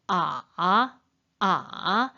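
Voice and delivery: each 아 dipping low, then rising; tone drill. Shown as a demonstration. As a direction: practise 아 with a fall and climb. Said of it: "이런 식으로 그림을 보고 연습".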